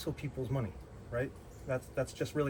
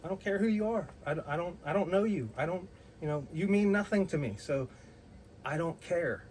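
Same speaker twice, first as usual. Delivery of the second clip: defiant retort; 5 semitones higher than usual. Retold or imitated. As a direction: brush her off with scorn. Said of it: "I was like".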